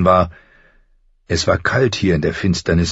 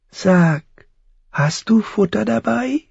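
very high crow voice; high, thin voice; 14 semitones higher than usual. A narrator's reading. Crow asks boy for food, high and thin. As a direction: ask in a thin, pitched-up bird voice. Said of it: "Die Stimme klang hoch und dünn".

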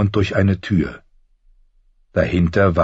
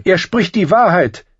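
high, loud urgent plea; agitated cry; 13 semitones higher, 5 dB louder than usual. A narrator's reading. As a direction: call out in high-pitched alarm. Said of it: "rief eine aufgebrachte Stimme".

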